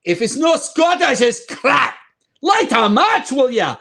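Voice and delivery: high, loud hammed-up Scottish voice; mock Scottish bravado; Scottish accent. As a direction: grumble it like a proud Scotsman. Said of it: "Scottish humor".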